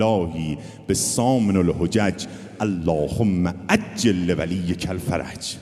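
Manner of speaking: loud, slow prayer call; preaching at full force. Acted. Preacher leading the congregation's closing prayer loudly.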